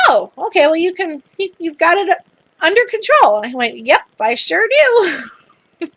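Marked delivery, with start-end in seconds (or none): 0.1-3.4 high, louder voice of an impressed nurse; retold surprised concession. Retelling nurse's impressed reaction. 3.8-5.9 retold cocky reply. Acting out own cocky reply.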